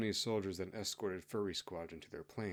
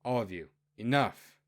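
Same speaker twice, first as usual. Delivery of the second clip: commanding queen; 7 dB louder than usual. Acted. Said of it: "Queen Ariana then stepped in and said".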